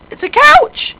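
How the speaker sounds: very high, loud squeal; startled shriek. Pretend. Player alarmed by a couch, high startled shriek. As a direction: shriek as if it were a monster.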